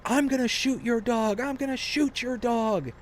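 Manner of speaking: high-pitched imitated yell of a threat; mock hollering threat. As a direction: holler it like the furious neighbor. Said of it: "he's hollering saying".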